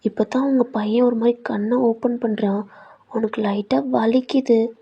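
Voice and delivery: plain reading voice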